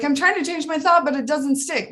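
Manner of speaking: high, complaining mom voice; lightly mocked frustrated client; whiny frustrated mom. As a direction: mimic a mom who feels stuck.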